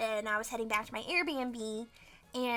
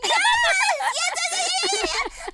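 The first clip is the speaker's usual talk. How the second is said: high, loud, delighted squeals; excited yes; 9 dB louder than usual. Real, not acted. Thrilled by her co-host's train nod story, squealing.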